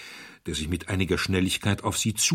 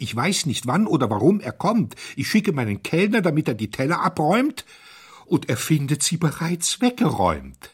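higher and louder, near panic; frantic; 8 semitones higher, 5 dB louder than usual. Acted. Panicked hotelier protests to the diners, higher and louder. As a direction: cry it out, wringing hands audibly.

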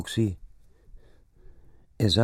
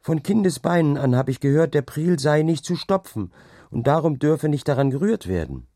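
raised, higher voice, pressing an objection; Ausruf; 7 semitones higher, 4 dB louder than usual. A narrator's reading. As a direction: call it out insistently, high and a little louder. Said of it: "rief sie wieder". (eindringlich gerufen, höhere Stimme)

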